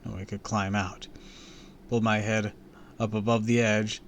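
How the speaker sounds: ordinary delivery, nothing marked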